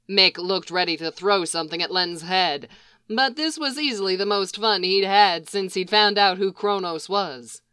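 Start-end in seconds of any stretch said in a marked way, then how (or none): none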